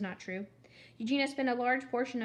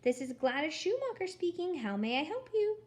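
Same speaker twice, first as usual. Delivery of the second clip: high, sugary receptionist voice, pitched up; annoyingly sweet voice; 7 semitones higher than usual. Acted. Gladys answering the phone at the company, annoyingly sweet. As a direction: answer the phone sickly sweet and far too pleasant. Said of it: "said an annoyingly sweet voice".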